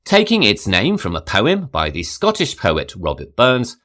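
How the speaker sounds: ordinary talk, nothing marked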